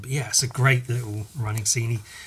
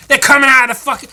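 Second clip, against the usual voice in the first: 1 panicked character; 9 semitones higher, 6 dB louder than usual. Acted.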